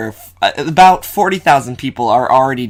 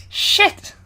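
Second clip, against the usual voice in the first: yelped curse. Real, not acted.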